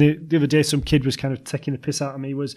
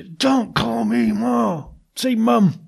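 higher, very slow scolding, a hammed-up cross mother; mock angry mum; 6 semitones higher, much slower than usual. Son imitating his mum scolding the kids, a mock slow angry telling-off. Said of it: "she used to get really angry".

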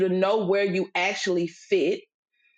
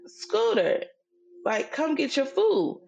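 higher, slower call with more insistence; firmer second call; 7 semitones higher, slower than usual. Retold or imitated. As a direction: call him again, pressing harder. Second call, child ignores her.

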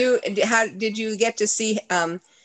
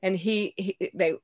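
ordinary talk, nothing marked

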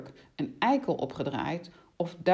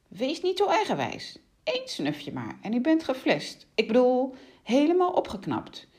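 higher, lightly acted coaxing witch; pushy coaxing, pitched up; insistent witch; 8 semitones higher than usual. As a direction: press a reluctant victim to give in. Plays the insistent witch Lila.

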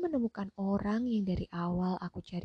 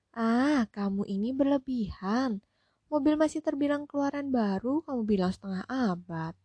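amused, louder teasing scold; lightly voiced rich lady; 5 semitones higher, 6 dB louder than usual. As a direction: brush off the flattery with a laugh. Hostess laughing it off. (nyonya rumah menepis pujian sambil bercanda)

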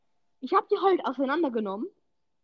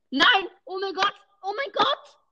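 shocked outcry; 9 semitones higher than usual. Real, not acted. Gamer realizing he lost the prize, shocked high cry. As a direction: yelp in horror at the loss. Losing a big game prize.

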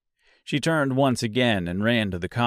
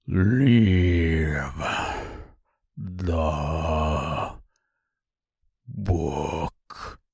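low, slow brute voice; deep gurgling voice; 6 semitones lower, much slower than usual. Acted. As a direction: growl it out like a monster. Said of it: "in a deep, gurgling voice".

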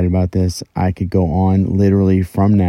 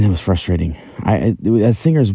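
ordinary delivery

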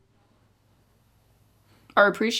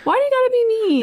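much higher whiny complaint; mock hurt whine; 13 semitones higher, 3 dB louder than usual. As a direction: whine like you're wounded.

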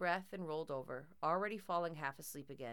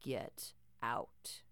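low, cold whisper; a ghostly warning breathed out; menacing whisper; 6 semitones lower than usual. A narrator's reading. Toy warns the girl, low menacing whisper. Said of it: "Grandpop's more gravelly voice".

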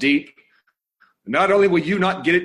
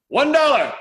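much higher, louder call of the bid; 12 semitones higher, 6 dB louder than usual. Acted. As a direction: call it out across the room. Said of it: "And he calls out".

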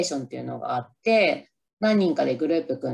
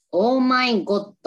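slow, exaggerated Oh my God; drawn-out Oh my God; 7 semitones higher, much slower than usual. Shown as a demonstration. Vlogger quotes the movie's famous exclamation, drawn out. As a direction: stretch Oh my God out slowly. Quoting an English movie line.

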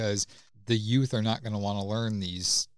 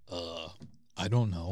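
dopey voice impression; 4 semitones lower, 4 dB quieter than usual. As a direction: play it dumb and low. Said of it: "I can't do that voice anymore".

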